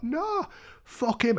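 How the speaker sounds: high, drawn-out refusal; defiant drunk woman. Retold or imitated. Woman refusing mum's pleas to come away, defiant.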